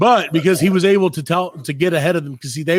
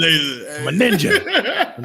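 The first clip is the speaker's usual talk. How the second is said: mock boast; much slower than usual. Bragging as internet spy.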